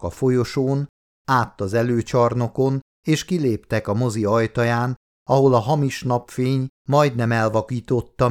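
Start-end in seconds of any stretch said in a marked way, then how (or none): none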